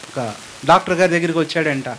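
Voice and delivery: ordinary talk, nothing marked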